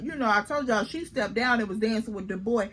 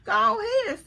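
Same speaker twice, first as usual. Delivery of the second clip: lifted, higher voice for the quote; high quoted voice; 10 semitones higher than usual. Retold or imitated. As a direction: voice someone higher up. Quoting someone at the party.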